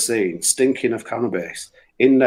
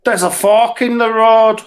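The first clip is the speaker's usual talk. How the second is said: joking lad; 10 semitones higher, 6 dB louder, slower than usual. Retold or imitated. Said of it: "and then he goes".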